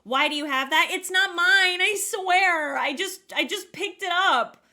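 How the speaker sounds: high, loud mock protesting; defensive. Wife swearing to husband it's not hers, defensive. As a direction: act out a flustered back-and-forth. Acted quarrel about the knife.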